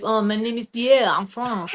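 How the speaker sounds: low-key imitation of a French accent. Introducing himself at jam night, French accent. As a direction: softly put on a French accent. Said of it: "when I do this accent".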